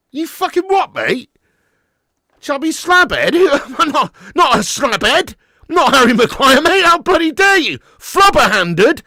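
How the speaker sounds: loud, high, hammed-up indignation; mock outrage. Real, not acted. Streamer answering a viewer who called him chubby slabhead, mock outrage.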